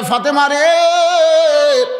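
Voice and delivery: high, drawn-out chanted cry; impassioned melodic wail of a call. Retold or imitated. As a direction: call out the name long and ringing, then carry on high. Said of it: "রাসূল চিৎকার দিয়ে বলে".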